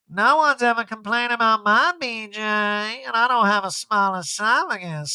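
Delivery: Erica impression. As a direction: purr it out smugly. Erica voiced.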